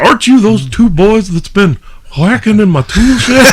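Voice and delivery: high, loud old cartoon neighbor; put-on high voice, indignant; Mr. Anderson, Hank Hill style. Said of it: "that was pretty decent Hank Hill".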